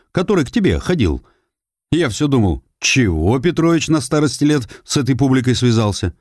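put-on hint at shady company, old man's voice; old neighbour voice. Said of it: "Нефёдов провёл ребром ладони". (старик с намёком)

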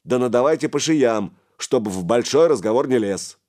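irritated boss's order; giving orders. A narrator's reading. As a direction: order it loudly as the boss. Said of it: "распорядился Гораст".

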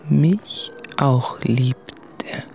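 breathless hushed whisper; slow unvoiced murmur to himself. (geflüstert, langsam)

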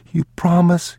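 put-on high child's voice; small, high, pleading question; young boy. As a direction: ask like a little boy seeking reassurance. Frightened boy voiced in story.

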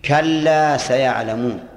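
very slow with weight on كلا; slow quoted verse. Shown as a demonstration. Teacher giving the sin example to students, very slow. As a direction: draw out كلا سيعلمون slowly for the class. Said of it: "يعرف بالسين".

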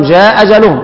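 جاء أجلهم read as جا أجلهم; hamza dropped. Shown as a demonstration. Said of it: "هكذا، هذا معنى الإسقاط".

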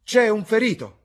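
high, full-force yell of warning; loud shout. A narrator's reading. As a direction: shout an order across a field. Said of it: "urlò a gran voce".

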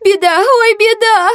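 very high, distraught lament; wailing maid. A narrator's reading. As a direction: wail in a squeaky servant voice. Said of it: "всхлипнула служанка". (причитающая служанка, тонким голосом)

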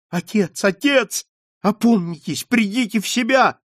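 high, urgent plea; crying out. A narrator's reading. Margarita calling to her mad father, crying out. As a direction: cry out to bring someone to their senses. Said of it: "закричала Маргарита".

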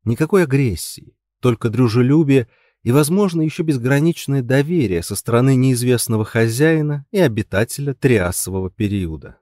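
plain reading voice

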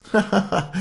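high, loud acted laugh; mocking laugh. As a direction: laugh derisively at her. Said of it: "lachte die Magd". (spöttisches Auflachen)

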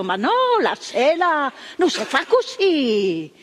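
a mild mock yell, pitched high; retold cry of protest. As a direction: call out to stop them, half reliving it. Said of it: "che io ho gridato".

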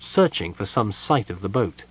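plain reading voice

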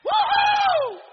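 very high shouted woohoo; jubilant whoop. Real, not acted. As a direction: whoop out in praise. Preacher whoops in worship. (grito agudo de alegría)

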